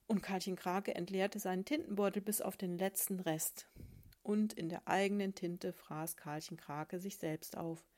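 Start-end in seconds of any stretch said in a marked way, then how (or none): none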